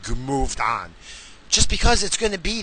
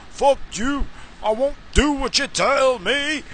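mock rebel voice; 9 semitones higher, 4 dB quieter than usual. Mocking the band's old slogan.

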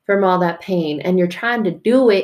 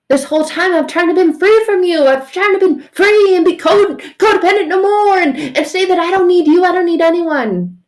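high, louder whining protest; whiny complaint; 11 semitones higher, 7 dB louder than usual. Acted. Person to God, high whiny complaint. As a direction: whine like a defiant teen.

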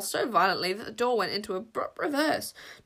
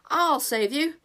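brave shout; 6 semitones higher, 6 dB louder, slower than usual. A narrator's reading. Bodkin's brave promise.

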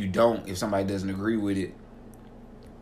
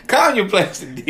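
loud, high, drawn-out scolding; hammed-up comeback on the edge of laughter; mock exasperated comeback; 10 semitones higher, 7 dB louder, slower than usual. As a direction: yell a joking telling-off, laughing. Acted comeback to the coworker.

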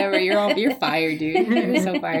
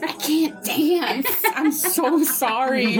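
apologetic; 3 semitones higher, much slower than usual. Retold or imitated. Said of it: "I was like".

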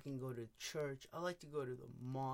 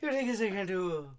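impersonation of an Indian teacher; 3 semitones higher, 11 dB louder than usual. Retold or imitated. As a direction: play the workshop guru teaching voice. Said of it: "such a good impersonation".

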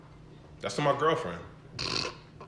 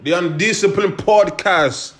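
slow, raised hype-man intro; announcer intro; 5 semitones higher, 3 dB louder than usual. Acted. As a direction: announce like a late-night host. Podcast host intro voice.

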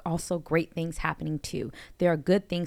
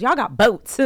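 put-on louder country-accent punchline; 4 semitones higher, 8 dB louder, slower than usual. Comedian's punchline about boats, loud country accent. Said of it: "he was a real country, like, strong sound in his voice".

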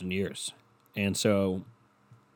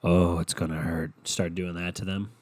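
low, soft mock sympathy; mock pity; 6 semitones lower, 7 dB quieter than usual. Warning dog owners of future grief, soft and mocking. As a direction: murmur doom at the dog owners, lightly mocking. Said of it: "just to fucking start being like".

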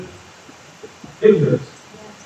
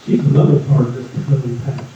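impersonating the pastor; 8 semitones lower, faster than usual. Retold or imitated. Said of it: "he's gonna say".

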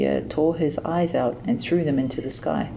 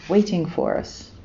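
ordinary delivery, nothing marked

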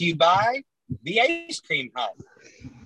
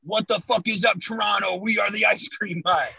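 raised hype shout; hype announcer; 6 semitones higher than usual. Shown as a demonstration. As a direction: call it out like a hype man. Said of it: "Hey, say".

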